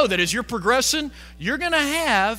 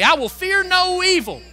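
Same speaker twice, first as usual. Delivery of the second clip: declared aloud; 8 semitones higher, 7 dB louder than usual. Shown as a demonstration. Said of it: "Somebody say that with me".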